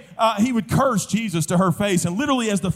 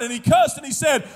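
normal talking voice

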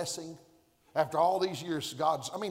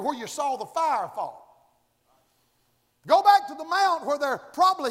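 normal talking voice

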